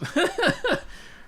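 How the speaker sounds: high, self-amused laugh. Real, not acted. Speaker reflecting on who he is now, laughing.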